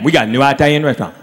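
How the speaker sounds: quick line with "Italian" as EYE-talian; small-town mispronunciation. Retold or imitated. Brother-in-law announcing a restaurant, "Italian" as EYE-talian. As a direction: rattle it off, saying "Italian" with a long "eye" start. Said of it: "He's like".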